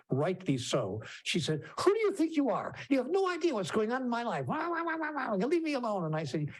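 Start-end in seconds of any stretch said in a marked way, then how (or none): 1.8-6.0 angry mother. Retold or imitated. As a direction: lash out at the stranger who interfered. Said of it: "she laid right into me".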